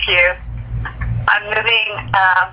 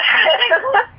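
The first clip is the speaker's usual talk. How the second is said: high, delighted laugh; happy laugh; 13 semitones higher than usual. Real, not acted. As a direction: laugh with relief and joy. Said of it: "Call made me feel so happy".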